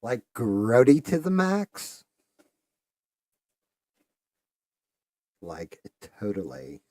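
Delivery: put-on slow, high, loud drawn-out Valley Girl. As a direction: ham up a ditzy Valley Girl, stretching every word. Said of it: "girls used to walk around and actually talk like that".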